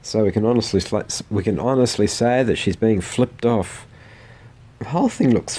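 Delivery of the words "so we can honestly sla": ordinary delivery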